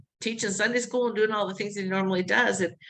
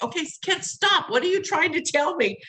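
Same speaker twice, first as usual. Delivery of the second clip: much higher, urgent call to a spirit; exasperated plea; 9 semitones higher than usual. Retold or imitated. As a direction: call out to stop the flitting. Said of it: "And I'm like".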